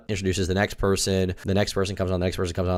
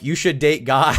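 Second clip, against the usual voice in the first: retold loud heckle; 5 semitones higher, 4 dB louder, slower than usual. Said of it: "I said, pretty loud".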